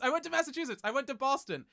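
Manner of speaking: suddenly remembering, pleased to have an answer; excited realisation. Real, not acted. Streamer recalls a state visited on tour, excited.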